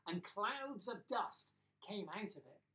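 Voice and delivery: plain reading voice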